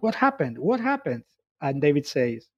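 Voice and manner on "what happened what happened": alarmed asking. Acted. Angie and Bernhard rush in.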